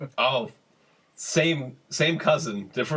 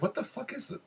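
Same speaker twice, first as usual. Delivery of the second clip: confused dealer; 5 semitones higher than usual. Retold or imitated. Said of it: "He's looking at it like".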